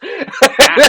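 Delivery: a burst of real laughter at his own joke; real high laugh. Real, not acted.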